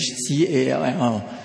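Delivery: C A L L spelled letter by letter, slowly; spelling out. Shown as a demonstration. Preacher spelling out the English word call. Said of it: "very simple in English".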